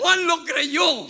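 shouted slow, pitch high, every word hammered; preaching shout. Real, not acted. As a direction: cry it out to the whole church. Preacher repeats, building to shout. (grito de predicador, lento y fuerte)

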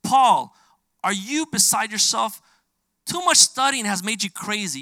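Festus's loud voice. Retold or imitated. Festus shouting at Paul before the king, loud and scornful. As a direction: yell it at him as if he's lost his mind. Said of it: "Festus says in a loud voice".

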